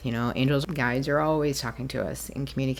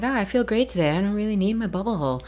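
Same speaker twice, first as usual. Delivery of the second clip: higher-pitched, airy dismissal; light mock nonchalance, pitched up; breezy; 6 semitones higher, much faster than usual. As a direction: imitate a carefree, overconfident beginner. Mimics her breezy past self.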